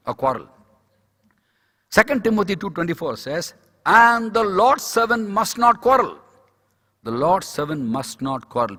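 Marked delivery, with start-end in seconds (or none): 3.9-6.2 loud, high, slow scripture quote; emphatic proclamation. Real, not acted. Preacher quoting Timothy to the congregation, emphatic proclamation. Preaching a verse, then repeating it. 7.0-8.8 slow, lower restatement of the verse; measured repetition. Real, not acted. Preacher restating the verse as a rule, measured. Same verse repeated more soberly.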